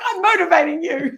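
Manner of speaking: high, loud insistent self-quote; over-eager speaker shouting encouragement; mock motivational yell. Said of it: "Why are you yelling at me?".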